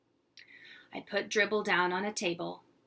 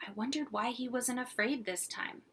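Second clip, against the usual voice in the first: ordinary delivery, nothing marked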